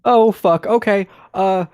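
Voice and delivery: high, loud burst of panic; alarmed reaction. Real, not acted. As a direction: swear in sudden high-pitched alarm. Player reacts to monster bursting in.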